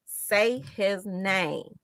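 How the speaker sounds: slow, each word stretched: SAY, HIS, NAME; deliberate, stretched repeat for emphasis; drawn-out slogan. Real, not acted. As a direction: spell out the demand slowly, word by word.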